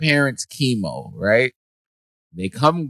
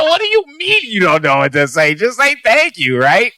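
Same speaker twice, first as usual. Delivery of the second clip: incredulous retort; 9 semitones higher than usual. Retold or imitated. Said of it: "I was just like".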